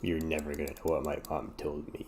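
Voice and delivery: low, soft teasing aside; quiet teasing. Real, not acted. Teasing the viewers, low and soft. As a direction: murmur it teasingly.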